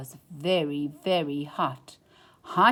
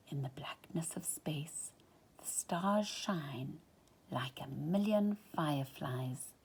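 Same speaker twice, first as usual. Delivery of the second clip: hushed whisper; 3 semitones lower, 7 dB quieter than usual. A narrator's reading. Narrator whispering to a child about stars in blackness. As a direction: let it drift out softly.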